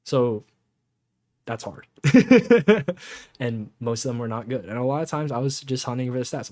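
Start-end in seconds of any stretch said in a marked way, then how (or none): 2.0-3.3 short amused cackle; real laugh. Real, not acted. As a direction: burst of loud high laughter. Laughs at his own hard goal.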